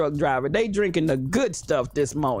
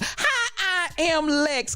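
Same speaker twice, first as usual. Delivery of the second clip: laughing greeting; 10 semitones higher, much slower than usual. Real, not acted.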